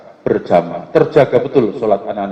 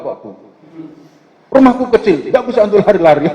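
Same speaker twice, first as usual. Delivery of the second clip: put-on higher, louder childlike protest; child's reply; 8 semitones higher, 4 dB louder, faster than usual. Child protesting to the elder that home is too small, higher and louder. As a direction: answer back like a cheeky little kid.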